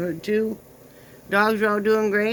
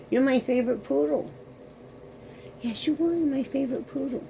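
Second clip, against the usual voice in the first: cooing; 6 semitones higher, 3 dB quieter than usual. Real, not acted. Cooing at the dog.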